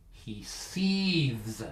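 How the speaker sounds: quiet, drawn-out hiss of restraint; seething. Acted. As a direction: hold the anger in, softly. Acts out dad's quiet anger.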